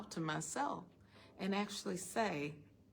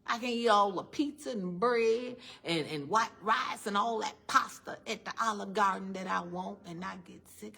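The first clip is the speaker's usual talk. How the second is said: ordinary talk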